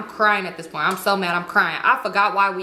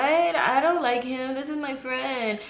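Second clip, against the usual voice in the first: slow, drawn-out mock innocence; mocking excuse; 3 semitones higher, 4 dB quieter, slower than usual. Mocking someone playing in her face, drawn-out imitation. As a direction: stretch the words out in mockery.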